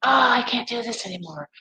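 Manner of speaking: high, exasperated mock outburst; mock blow-up. Acting out going off the handle on people, mock exasperated. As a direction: act out flying off at someone in a high voice. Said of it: "fly off on people".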